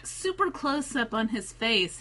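normal talking voice

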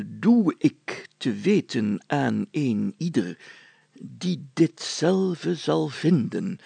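put-on slow, ceremonious old-document reading; solemn reading. A narrator's reading. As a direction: declaim the ancient text slowly. Mayor reads old document. (plechtig voorlezen)

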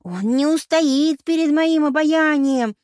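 loud, high, drawn-out boast; triumphant roar. A narrator's reading. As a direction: declare victory at full voice. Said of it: "торжествующе взревела Пенелопа". (торжествующе, во весь голос)

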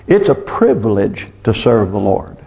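usual speaking voice

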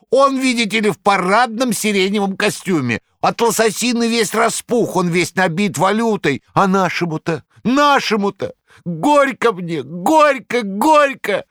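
very high, loud lamenting howl; wailing like a best man. A narrator's reading. Korovyev howling to the crowd, high mock lament. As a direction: wail it like a wedding toast. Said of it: "завыл Коровьев, как шафер на старинной свадьбе".

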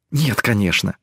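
usual speaking voice

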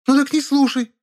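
very high yell; shrill shout. A narrator's reading. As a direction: scream it back. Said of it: "закричала Зайка". (визгливый крик)

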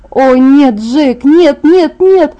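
high, loud panicked protest; horrified gabble. A narrator's reading. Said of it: "тараторила я в ужасе". (в ужасе, громко и высоко)

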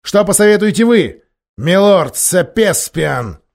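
loud, high, drawn-out demand; demanding king. A narrator's reading. Miraz turning to Sopespian for advice, loud demanding tone. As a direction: press the other lord hard.

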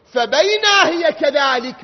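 slow, loud preacher's chant; fervent preaching. Acted. Preacher recounting to the congregation, slow fervent chant. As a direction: draw the mourners into the story.

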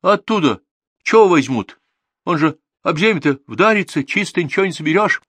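high, loud, wailing worry; agitated village woman fretting shrilly; flustered alarm. A narrator's reading. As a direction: burst out in sudden agitation. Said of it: "вдруг всполошилась".

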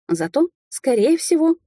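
ordinary delivery

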